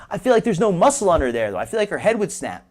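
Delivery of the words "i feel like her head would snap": ordinary delivery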